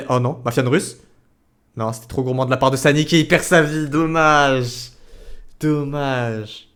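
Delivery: lively live play-by-play; esports caster. Acted. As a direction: call the match as it happens.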